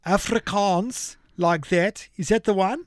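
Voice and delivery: bad, hammy South African accent; high, slow South African accent. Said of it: "do my bad South African accent".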